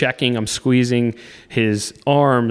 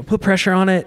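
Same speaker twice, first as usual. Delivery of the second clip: higher, louder retold yell; urgent shout; 8 semitones higher, 3 dB louder than usual. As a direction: call out urgently, as if in the moment. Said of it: "And so I'm screaming".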